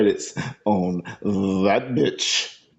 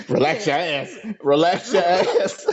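laughing; 7 semitones higher than usual. Acted. Acted bedroom line, cracking up.

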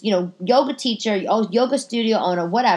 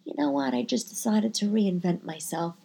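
cool [name]; 7 dB quieter than usual. Retold or imitated. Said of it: "[name] would just be like".

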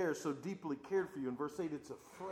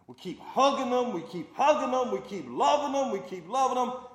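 loud, high, hammering repetition; preaching refrain at full force; 10 semitones higher, 13 dB louder than usual. Acted. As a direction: drive the refrain home louder each time.

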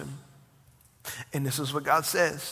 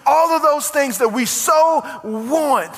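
higher and louder, driving the sermon home; preaching at full force; 9 semitones higher, 8 dB louder than usual. Acted. Pastor stirring his congregation, preaching at full force. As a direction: preach it big and rising to the crowd.

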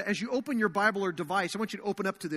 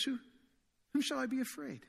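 softer, unshaken whom shall I be afraid; hushed, sure-footed whom with no alarm; quiet confident question; 4 semitones higher, 8 dB quieter than usual. Retold or imitated. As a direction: say it calmly, fearless under pressure. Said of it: "But David says".

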